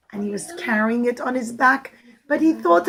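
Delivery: ordinary delivery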